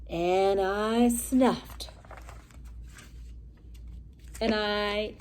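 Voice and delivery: very slow drawn-out snuff; drawn-out build. Acted. Wolf building up to a sneeze, very slow. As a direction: stretch it out before the sneeze.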